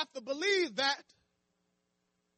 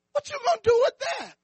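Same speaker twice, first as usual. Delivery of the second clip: higher, fast jeering question; mocking scoff; 9 semitones higher, 5 dB louder, faster than usual. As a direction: taunt him with a quick sneer. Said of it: "that's what they were saying".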